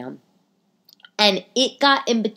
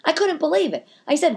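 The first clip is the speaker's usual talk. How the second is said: ordinary delivery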